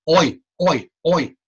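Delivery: oi split into O and E; diphthong demo. Shown as a demonstration. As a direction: glide through oi. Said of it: "Just like oil".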